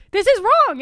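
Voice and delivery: loud, high burst of exasperation; indignant outburst. Real, not acted. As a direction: burst out in protest. Outburst at bad accents.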